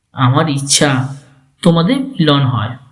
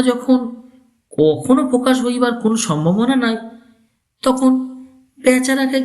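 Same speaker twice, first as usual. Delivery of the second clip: put-on old uncle persuading; 7 semitones higher than usual.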